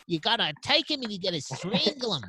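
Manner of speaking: over-the-top Aussie Alfred egging Batman on; Australian butler. Acted. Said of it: "hit me with more Australian butler".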